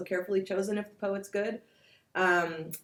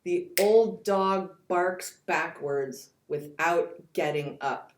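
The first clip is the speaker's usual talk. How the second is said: very slow, each stress weighed down; stiff, slow recitation of the old dog line; slow, heavy reading; slower than usual. Shown as a demonstration. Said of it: "Listen to the first one".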